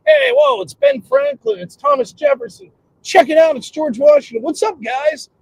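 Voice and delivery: high-pitched excited greeting; starstruck. Acted. Newcomer to heaven greeting founding fathers, acted high excitement. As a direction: call out to heroes in delight.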